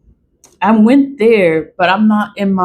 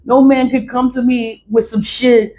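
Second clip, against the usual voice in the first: ordinary delivery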